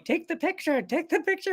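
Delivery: be a pushy bird begging for the shot; raven voice. Pretend. Ravens on the beach urging the photographer, high squawky bird voice. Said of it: "The ravens are going".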